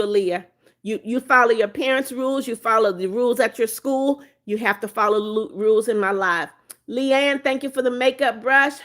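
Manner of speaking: normal talking voice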